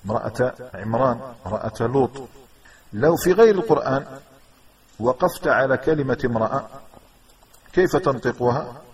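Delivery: ordinary talk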